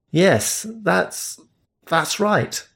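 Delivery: lightly voiced awkward Englishman; nervous agreement. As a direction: trail off, caught out.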